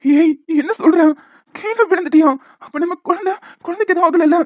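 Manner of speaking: high, loud frantic questions; husband panicking about wife's fall and the baby, alarmed; panicked alarm. Acted. Husband panics over fallen wife.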